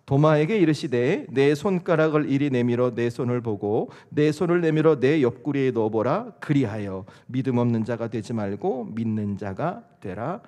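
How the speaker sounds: loud, measured congregational reading; scripture reading. Acted. Pastor leading congregation in reading verse twenty-seven, solemn reading. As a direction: read it out firmly, slowing into the last verse.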